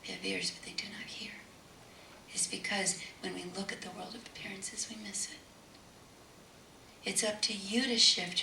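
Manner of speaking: soft, breathy hush throughout; whispered sermon. Real, not acted. Preacher urging spiritual awareness, whispering. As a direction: draw them in with a whisper.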